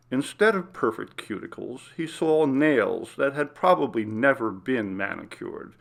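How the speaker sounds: ordinary delivery, nothing marked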